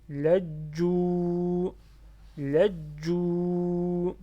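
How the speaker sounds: LAJJU with a long held final 'u'; loud recitation, stretch the end of LAJJU; prolonged final vowel. Shown as a demonstration. Teacher demonstrating to learners, LAJJU with its final 'u' drawn out. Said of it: "On prolonge".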